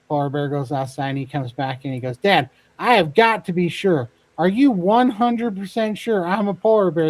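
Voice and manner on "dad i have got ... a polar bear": hammed-up desperate cub, high and loud; insistent pleading. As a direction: plead like a cub demanding certainty, playacted. Cub desperately demands certainty from dad.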